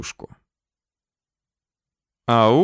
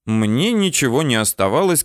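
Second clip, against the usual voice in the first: plain reading voice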